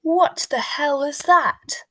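drawn-out shout of outrage; outraged bellow. A narrator's reading. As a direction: bellow it slowly in disbelief. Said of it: "Uncle Vernon bellowed".

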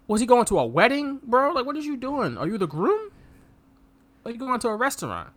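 high, incredulous teasing; high mocking questions; mocking disbelief. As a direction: scoff at the outfit like a friend roasting him.

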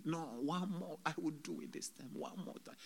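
hushed, quick mock scream; retold screaming plea. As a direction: rattle off the plea softly, fading to breath. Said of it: "And I was screaming".

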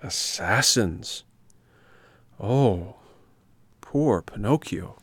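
slow, drawn-out commiseration; sympathetic fox. Acted. Fox pitying the attacked puppet, slow sympathetic voice. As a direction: draw out the pity word by word.

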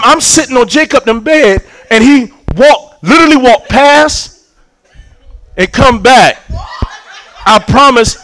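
ordinary delivery

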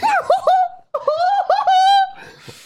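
shrieking burst of laughter; loud shrieking laugh. Real, not acted. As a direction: laugh so hard it distorts the mic. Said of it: "Das war übersteuert".

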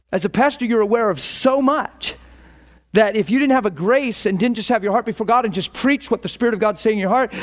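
ordinary talk

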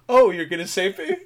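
spring back to life in surprise; mock surprise. Retelling the dying woman hearing of rescue, mock surprised.

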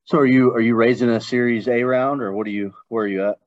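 ordinary talk

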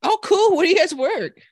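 high, bright-sounding mock barista chirp; imitating the barista's high chirpy greeting; chirpy barista impression. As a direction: squeal it like an overeager barista.

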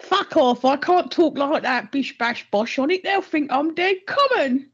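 exaggerated rough cockney, taking the piss; mock common voice. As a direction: ham up a broad common accent. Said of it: "one's a taking the piss voice".

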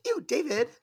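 high, disgusted Ew David; Schitt's Creek whine. Retold or imitated. Quoting Schitt's Creek to cohosts, mock disgusted high whine. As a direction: whine it like a spoiled sister. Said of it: "every time she said David".

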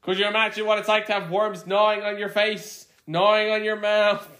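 high-pitched mock hellfire sermon; hammed-up preacher. Podcaster mimicking a propaganda film's warning, hammed-up preacher.

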